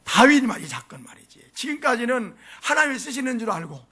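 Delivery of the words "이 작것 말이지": hushed, scornful aside; whispered aside. Acted. Soldiers muttering about David behind his back, whispered. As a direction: breathe the grumble out softly.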